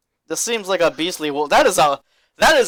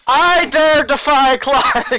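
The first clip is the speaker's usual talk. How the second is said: high, loud, slow battle cry; defiant shout; 10 semitones higher, 8 dB louder, much slower than usual. Pretend. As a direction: bellow it defiantly at the monster. Player shouts defiance at bugbear.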